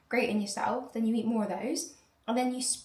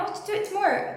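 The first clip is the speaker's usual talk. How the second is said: high, sing-song mimicry; airy mock voice of well-meaning people; mocking others' advice; 11 semitones higher, 3 dB louder, faster than usual. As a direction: parody the breezy advice-givers. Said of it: "And then I".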